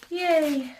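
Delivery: high playful yay; a small bright celebratory cheer; little cheer. Real, not acted. Wrapping up the book, playful cheer.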